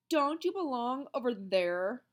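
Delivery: sneering schoolboy, slow; slow jeering boy; mocking taunt. A narrator's reading. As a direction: tease him with a sneer.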